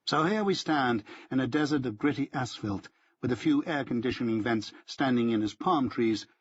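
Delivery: plain reading voice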